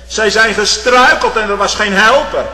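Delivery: loud, high fervent preaching; impassioned sermon climax; preaching at full force. Acted. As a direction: thunder it from the pulpit.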